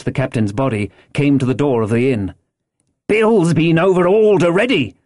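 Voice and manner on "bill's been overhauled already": higher-pitched buccaneer reporting; pirate henchman's high reply; another fellow. A narrator's reading. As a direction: call out the report in a raised voice. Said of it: "said he".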